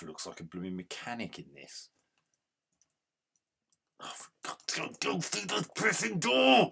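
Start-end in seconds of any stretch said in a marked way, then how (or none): 4.0-4.6 whispered exasperation; exasperated whisper. Real, not acted. Exasperated muttering. 4.7-6.7 high, loud angry yell; furious yell. Real, not acted. Yelling at game character.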